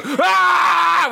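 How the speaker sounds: high hammed-up squeal; loud mock squeal; mock crowd scream. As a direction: pretend men shrieking at a stripper. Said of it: "And we go".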